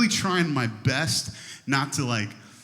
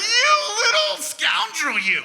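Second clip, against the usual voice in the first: high, slow mock scolding yell; hammed-up telling-off of the toddler; 5 dB louder, slower than usual. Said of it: "I can just yell at him".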